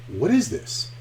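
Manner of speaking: high-pitched swoop of disbelief; amazed question. Retold or imitated. Diner asking the cook about the dish, amazed. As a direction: ask it in wonder, pitch jumping up.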